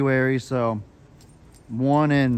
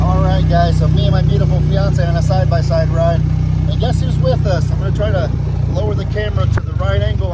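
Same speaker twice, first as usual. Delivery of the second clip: raised voice over engine; 4 semitones higher, 7 dB louder than usual. Real, not acted. Talking over side-by-side engine.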